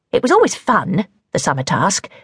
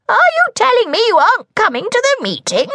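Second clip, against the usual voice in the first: crying out. A narrator's reading. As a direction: cry out in disbelief. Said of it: "cried the hag".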